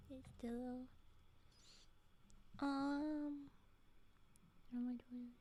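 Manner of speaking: normal talking voice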